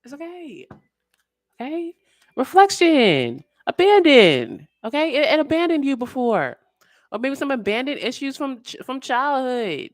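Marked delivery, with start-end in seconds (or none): 2.4-4.7 slow loud pronouncement. Real, not acted. Reader announcing the cards, slow and loud. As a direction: draw each word out, louder.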